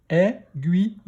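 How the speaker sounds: "Aiguille" split into è, gui, ye; "Aiguille" in three separate beats; syllable by syllable. Shown as a demonstration. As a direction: sound out "Aiguille" with a final ye. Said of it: "Première syllabe".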